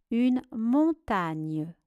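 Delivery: "montagne" slow, split after mon; syllable by syllable. Shown as a demonstration. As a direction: draw out "montagne" in separate syllables. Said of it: "Première syllabe mon". (« montagne » lentement, syllabe par syllabe)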